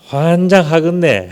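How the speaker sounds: slow, exasperated Jeolla accent; Jeolla dialect. Shown as a demonstration. Preacher illustrates denial as Jeolla folk say it, slow Jeolla accent. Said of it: "전라도 말은 뭔가?".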